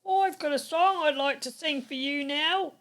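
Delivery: eager little announcement; high eager sidekick. Acted. Bob offers the host a song, high and eager.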